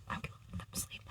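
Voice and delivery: whispered, barely audible afterthought; hushed repeat. Real, not acted. Insisting to viewers a guest may sleep, hushed whisper. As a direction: drop to a tiny unvoiced whisper.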